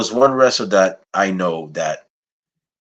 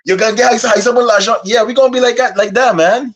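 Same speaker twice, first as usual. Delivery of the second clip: high, playful put-on Guyanese accent; 13 semitones higher, 3 dB louder than usual. Host imagining himself and his brothers in the ring, put-on Guyanese accent. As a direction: talk it up in a hammed Guyanese accent. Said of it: "in our Guyanese accent".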